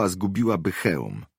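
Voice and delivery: ordinary delivery, nothing marked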